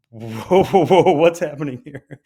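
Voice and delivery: loud alarmed residents going whoa. Retold or imitated. Residents reacting to the argument, loud alarm. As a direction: cut in with startled alarm.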